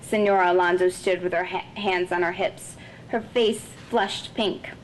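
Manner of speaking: usual speaking voice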